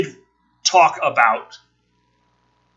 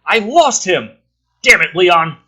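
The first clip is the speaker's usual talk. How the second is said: cop yelling; 5 semitones higher, 8 dB louder, slower than usual. Acted. Rookie cop yells to officers.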